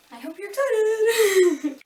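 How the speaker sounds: high, loud, drawn-out cheer breaking into laughter; gleeful, slow singsong whoop; hyped-up excitement. Real, not acted. Teasing hype before burpees.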